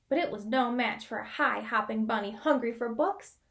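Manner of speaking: plain reading voice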